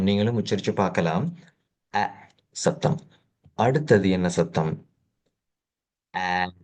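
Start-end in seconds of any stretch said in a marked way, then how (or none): 1.9-3.0 அ said short and slow, a clipped open a; short a sound. Shown as a demonstration. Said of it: "உச்சரிச்சு பார்க்கணும்". 6.2-6.5 ஆ stretched into a long open aa; long aa sound. Shown as a demonstration. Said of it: "கொஞ்சம் நீட்டின மாதிரி".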